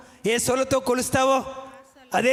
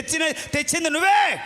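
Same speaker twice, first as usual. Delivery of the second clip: higher, shouted accusation; accusing shout; 5 semitones higher than usual. Acted. Preacher accusing the mother-in-law, shouted. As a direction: hurl the blame at her.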